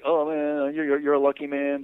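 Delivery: high, loud gushing imitation; envious admiration. Guys to the husband about his luck, envious admiration.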